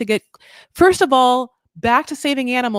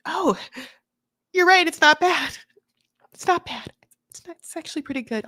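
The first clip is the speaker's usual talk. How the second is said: mock tearful surprise; 7 semitones higher than usual. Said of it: "she is like".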